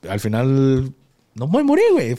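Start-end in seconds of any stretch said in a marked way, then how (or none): 1.3-2.2 much higher and louder; emphatic punchline. Real, not acted. Telling the time-machine question answer, emphatic. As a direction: hit the punchline hard.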